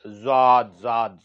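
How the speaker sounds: ordinary talk, nothing marked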